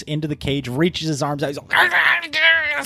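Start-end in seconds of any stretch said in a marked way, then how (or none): 1.6-2.8 high retold howl; hammed-up scream at the bells; mock scream. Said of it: "screaming towards the bells".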